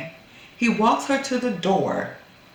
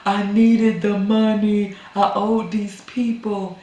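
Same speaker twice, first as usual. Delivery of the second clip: pleading husband; 3 semitones higher, slower than usual. Retold or imitated. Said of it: "he tried to be all".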